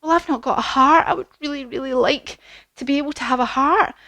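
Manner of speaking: high, hopeful character voice; Tin Man. Acted. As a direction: plead gently in a high voice. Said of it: "And he said".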